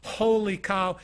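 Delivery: usual speaking voice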